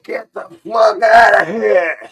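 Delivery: loud high yell at the dog; angry shout chasing the dog away; retold shout. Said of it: "I'm like".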